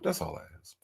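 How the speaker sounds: whispered, playful aside; a whispered afterthought to the viewers. Real, not acted. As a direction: drop to a breathy hush for the last line. Host's aside after shoes request.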